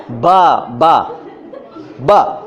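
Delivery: बा, बा, ब drawn out, lips shut then popped open; slow isolated ब, low, both lips releasing; lips-closed B. Shown as a demonstration. Teacher demonstrating the sound to students, lips-closed B. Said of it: "बंद हो गया, खुला".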